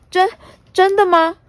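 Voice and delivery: high, shy disbelief; timid surprise. Acted. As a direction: ask it shyly, hardly believing.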